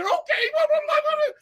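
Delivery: very high squeaky dog voice; Courage voice. Shown as a demonstration. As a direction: squeal it like a frightened cartoon dog. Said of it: "And I said".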